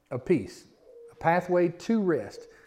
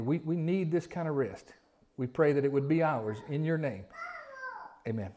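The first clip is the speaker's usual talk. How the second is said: softer, hushed prayer voice; 3 semitones lower, 7 dB quieter than usual. Acted. Pastor praying over the congregation, hushed. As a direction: pray quietly before the church.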